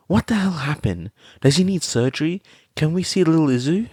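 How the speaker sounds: high, loud, fast panicked questions; frantic questions. A narrator's reading. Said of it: "Mitski questioned".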